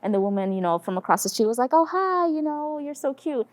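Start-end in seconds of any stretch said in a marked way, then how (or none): 1.7-3.5 put-on high-pitched greeting to a small child; cooing greeting. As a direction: imitate a woman cooing over a toddler. Imitates woman greeting a small child.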